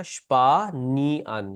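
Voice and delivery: स्पा, नी, अन broken apart, starting with sh; three separate beats, स्पा then नी then अन; syllable by syllable. Shown as a demonstration. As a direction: split the word into स्पा, नी, अन with small gaps. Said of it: "different syllables हैं".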